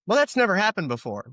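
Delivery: exaggerated fake astonishment; heavy sarcasm. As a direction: say it like it's obviously false. Sarcastic rhetorical remark.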